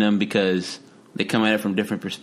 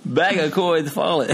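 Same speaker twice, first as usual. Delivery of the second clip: laughing; 6 semitones higher than usual. Real, not acted. Laughing at the joke.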